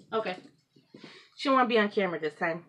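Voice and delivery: ordinary talk, nothing marked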